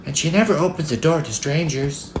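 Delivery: put-on high-pitched, innocent child's voice; high little-girl explanation. A narrator's reading. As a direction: confide it as a small girl. Little girl tells wolf about Grandma.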